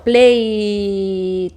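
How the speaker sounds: "play" held long with a voiced vowel; voiced ending. Shown as a demonstration. Teacher shows voiced final sound of "play". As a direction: stretch "play", keeping the vowel buzzing. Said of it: "el sonido es sonoro".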